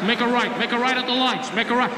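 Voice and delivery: imitated cop as angry GPS. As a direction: give directions like an irate satnav. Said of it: "like I chose angry cop".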